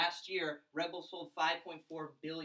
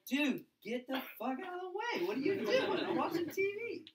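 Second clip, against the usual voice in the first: much higher, laughing mock outrage; annoyed younger self; 12 semitones higher, 4 dB louder than usual. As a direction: squeal it out, half-laughing, at the guy blocking the TV. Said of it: "He's like".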